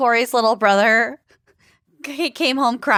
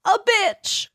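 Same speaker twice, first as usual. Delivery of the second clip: mock child; 9 semitones higher than usual. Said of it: "Alex was, like, crying".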